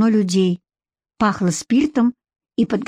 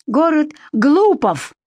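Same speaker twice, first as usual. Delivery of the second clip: slow, higher, sneering pronouncement; mocking; 7 semitones higher, slower than usual. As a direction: announce it with mockery. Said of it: "насмешливо произнёс Егор".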